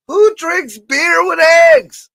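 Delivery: high, loud, drawn-out shout of disbelief; incredulous outcry. Real, not acted. Reacting to beer with eggs, loud disbelief. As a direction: shout it slowly, high and appalled.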